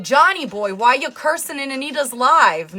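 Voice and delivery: a hint of an outraged mother; scolding mom. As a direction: scold like a mother catching her son cursing. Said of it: "and be like".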